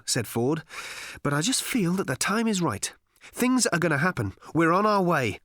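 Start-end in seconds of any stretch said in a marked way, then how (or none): 1.2-5.4 full of sudden energy and purpose; excited. A narrator's reading. Ford sensing the time is right to leave, excited. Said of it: "he said excitedly, and shivered with energy".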